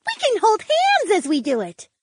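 very high, eager feline woman; high-pitched girlish enthusiasm; excited cry. Acted. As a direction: cry out keenly in a high little voice. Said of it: "the feline woman cried".

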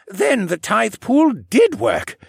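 put-on excited old koala working it out; old man voice. A narrator's reading. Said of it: "Jenner said".

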